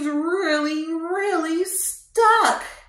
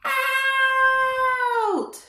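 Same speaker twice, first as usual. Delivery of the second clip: called out; 11 semitones higher than usual. Shown as a demonstration. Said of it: "let's say out on the count of three".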